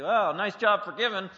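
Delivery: loud, high sarcastic yell; mock yelling. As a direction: yell it back as a hammed-up retort. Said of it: "You just yell at 'em".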